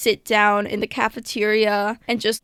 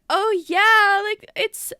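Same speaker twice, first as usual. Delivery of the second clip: laughing it off; 8 semitones higher, slower than usual. Retold or imitated. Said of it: "I'm literally laughing it off".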